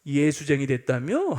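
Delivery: imitated teasing jeer; mocking friend. Close friend mocking the new believer, derisive. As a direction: lightly scoff as if ribbing a convert. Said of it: "이러면서 비웃더라는 거예요".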